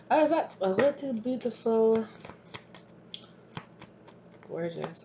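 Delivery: playful mock French accent; bad French accent. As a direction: slip into a hammy French accent. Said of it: "very bad French accent".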